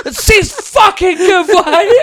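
high, loud road-rage yell, stretched out; acted-out furious outburst; snapped shouting. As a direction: bellow it at the other driver, pitch way up, laughter creeping in. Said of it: "just snapped".

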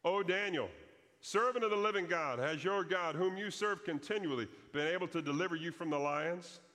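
high, loud call into a pit; a king calling down, fearful and urgent. Retold or imitated. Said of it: "Darius called down in there".

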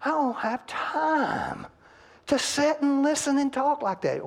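high, slow, whining complaint to the pastor; a congregant whining to the pastor that it's too much work; whiny excuse. Acted. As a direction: moan it like a put-upon husband. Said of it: "And you say".

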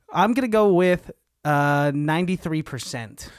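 ordinary talk, nothing marked

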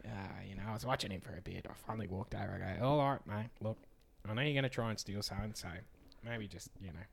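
put-on raspy old man voice. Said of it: "funniest little raspy old voice".

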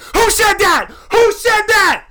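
very high, loud demand; imitated furious manager. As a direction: bellow for the culprit to own up.

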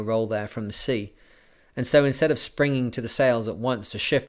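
steady, even audiobook reading; story narration. A narrator's reading. Podcaster reading a sailing memoir to listeners, measured narration. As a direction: read the voyage tale to listeners. Reads the book aloud.

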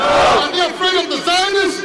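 high, forceful challenge to the crowd; rallying shout. Real, not acted. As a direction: fire the question out for a roared answer.

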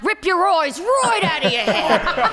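high-pitched, excited Australian wildlife presenter; Steve Irwin. Acted. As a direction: hype the danger in a big Australian accent. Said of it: "Steve Irwin".

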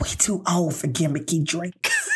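slow, put-on raspy man voice; mock-weary, drawn-out pronouncement; over-familiar date. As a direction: imitate a smug date laying down the law. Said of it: "he turns to me again".

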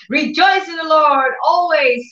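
high, ringing proclamation; preaching shout. Acted. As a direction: proclaim the verse to the crowd. Preacher quoting scripture aloud.

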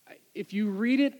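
ordinary talk, nothing marked

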